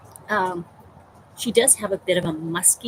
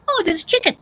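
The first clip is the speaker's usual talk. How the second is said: excited; 13 semitones higher, 7 dB louder, faster than usual. Retold or imitated. Husband voiced, excited.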